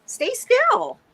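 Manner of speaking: high coaxing pet talk. Acted. Owner tells her cat to stay put, high pet voice. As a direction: ask the cat up high, sing-song.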